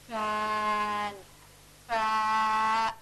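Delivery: "fan, fa," with the F held long, vowel late; prolonged F. Shown as a demonstration. Teacher showing prolongable sounds, F stretched. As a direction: draw out the F of "fan". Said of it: "a comes later on".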